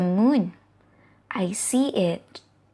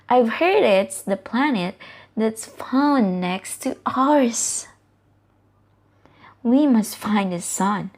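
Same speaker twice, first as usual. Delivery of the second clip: ordinary delivery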